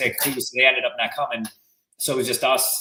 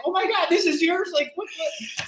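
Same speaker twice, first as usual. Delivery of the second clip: mock shocked squeal. Vanessa shocked, quoted.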